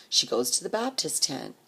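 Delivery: ordinary talk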